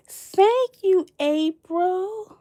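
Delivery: high, sweet thank-you; gushing thanks. Real, not acted. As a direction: coo the thanks to April.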